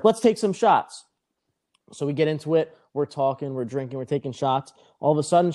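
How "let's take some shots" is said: higher, drawn-out party cheer; excited invitation. Retold or imitated. Woman at the bar invites him to drink, excited invitation. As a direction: imitate her rallying the bar, voice pitched up.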